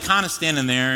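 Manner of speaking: ordinary talk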